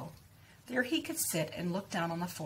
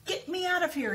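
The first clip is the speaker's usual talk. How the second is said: high yelled plea; yelling; 11 semitones higher, 3 dB louder than usual. A narrator's reading. Father stuck in the mud, yelling to be freed. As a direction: cry it out much higher. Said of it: "he yelled".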